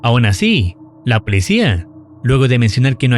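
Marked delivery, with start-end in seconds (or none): none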